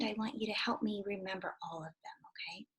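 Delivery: soft secret whisper. Shown as a demonstration. Teacher whispers instruction to class.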